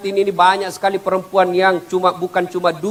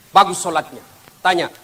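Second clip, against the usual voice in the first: ordinary talk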